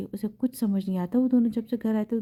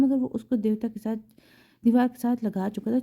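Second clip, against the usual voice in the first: usual speaking voice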